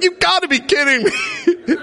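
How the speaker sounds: high, laughing disbelief; mock exasperated teen; exasperated son. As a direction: play the son who can't believe it. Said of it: "He's like".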